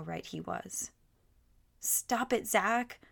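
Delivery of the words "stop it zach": put-on higher, pleading cry of a little girl. A narrator's reading. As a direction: protest at your brother, pitched up. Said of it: "Shelley cried".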